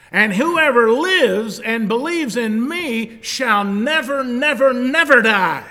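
full-force preaching. Acted. Preacher to congregation, rising thunderous climax.

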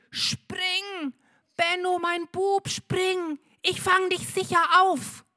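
high, urgent call over the fire; father calling up. A narrator's reading. Father below calls up to Benno, high and urgent. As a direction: call up to your son to jump. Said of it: "er ruft".

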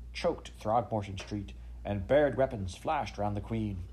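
plain reading voice

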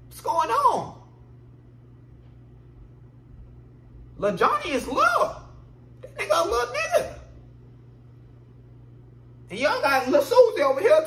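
high, loud, laughing disbelief; incredulous high shout. Real, not acted. Comedian yelping in disbelief at the crowd. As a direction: yelp it up in disbelief.

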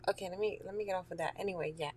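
ordinary talk

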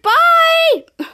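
high, chirpy goodbye; a cartoonish high bye; squeaky bye. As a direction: pipe it up high. Mika voiced leaving.

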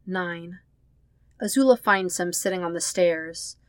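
ordinary delivery

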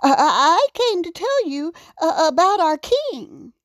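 high, hurried stammer; nervous stutter. A narrator's reading. Raccoon tells the giant about his king, stammering nervously. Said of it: "Adam stuttered".

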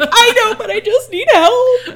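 loud high pleading, slow. Retold or imitated. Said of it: "And I was like".

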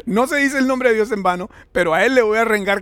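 father imitating his son's pious justification, amused; imitating self-righteous son. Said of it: "estaba como súper religioso".

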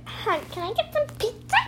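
high, sweet, innocent ask; sweet caller voice. Shown as a demonstration. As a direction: sound like a polite little kid. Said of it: "Just be like".

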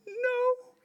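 high, drawn-out comic wail; panicked refusal. Acted. Scared dad answering if he's ready, high mock-panicked squeal.

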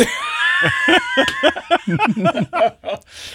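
big shrieking laugh. Real, not acted. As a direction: burst out laughing high. Big laugh after joke.

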